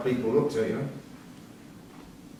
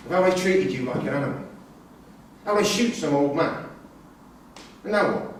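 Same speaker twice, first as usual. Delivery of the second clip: ordinary talk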